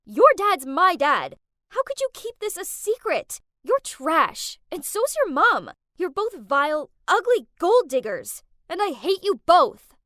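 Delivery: loud furious scream at her half-sister. Acted. Screaming at her half-sister over the phone, furious. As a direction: spit every word out in rage. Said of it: "I screamed at her".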